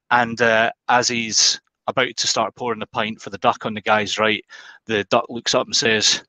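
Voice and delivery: normal talking voice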